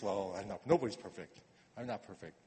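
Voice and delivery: soft, shrugging mock excuse; flippant excuse. Imitating the flippant churchgoer's excuse, softly. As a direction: toss it off like it doesn't matter. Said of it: "a flippant little excuse".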